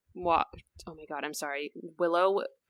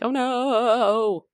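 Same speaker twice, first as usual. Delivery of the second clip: rising panicked oh no, much higher; a parent realizing the child grew up; mock panic; 7 semitones higher, 7 dB louder, much slower than usual. Mother panicking as her kid grows up, mock dismay. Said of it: "crises when your kid becomes an adult".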